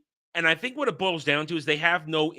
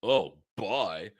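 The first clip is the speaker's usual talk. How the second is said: mocking guy; 8 semitones lower, 4 dB quieter than usual. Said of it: "guy says".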